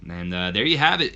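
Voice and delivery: ordinary delivery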